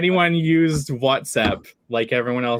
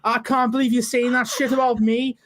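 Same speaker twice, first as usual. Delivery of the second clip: high-pitched whiny Scouse; Scouse accent; 9 semitones higher than usual. Shown as a demonstration. Showing friends a Scouse accent, high hammed-up Scouse whine. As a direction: complain in a broad, put-on Liverpool accent. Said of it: "can you do a Scouse accent".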